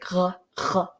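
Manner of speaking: "Huh, huh" as raspy back-of-throat French R sounds. Shown as a demonstration. Vlogger showing viewers the R sound in the back of the throat, throaty French R. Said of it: "R sound in the back of their throats".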